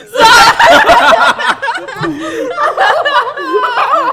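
long, high shriek of laughter; real uncontrolled cackling; loud laughter. Real, not acted. Punchline of her story.

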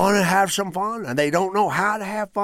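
nervous comic voice. Retold or imitated. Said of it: "My set started off like this".

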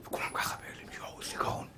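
hushed, breathless whisper of amazement; awed whisper. Retold or imitated. Congregants whispering in awe at the rabbi's genius, awed whisper. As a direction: whisper it like an awestruck onlooker.

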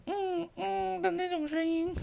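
very high, far eunuch voice; eunuch voice demo. Shown as a demonstration. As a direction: squeak it out like a court eunuch. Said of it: "用一个比较高，感觉像公公的声音".

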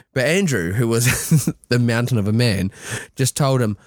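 ordinary delivery

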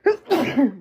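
sudden loud sneeze. Real, not acted. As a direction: let a sneeze burst out. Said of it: "I had to sneeze real bad".

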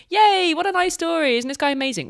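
high, loud mock-delighted cheer; gushing cheer. Cohost mimics the first wave of coverage, gushing high. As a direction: gush like delighted news coverage.

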